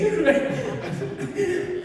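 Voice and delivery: high bright burst of laughter. Real, not acted. Friends remembering him, laughing out loud. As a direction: break into a loud laugh at the joke. Laughing at rest day joke.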